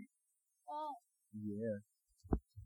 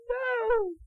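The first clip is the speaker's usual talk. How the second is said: squeaky piggy voice; 3 semitones higher, much slower than usual. Acted. Piggy refusing the wolf again.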